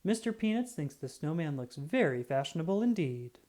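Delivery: ordinary delivery, nothing marked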